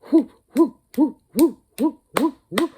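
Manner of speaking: short high loud whoops; imitated crowd whooping. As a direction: whoop like an approaching rowdy crowd. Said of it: "we hear".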